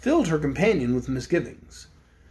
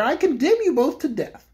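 higher-pitched mock-solemn king voice; king condemning the prisoners, higher voice; Bear King; 8 semitones higher than usual. A narrator's reading. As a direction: pronounce the sentence pompously, pitched up. Lavender Bear king's sentence.

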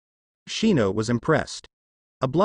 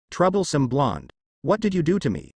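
usual speaking voice

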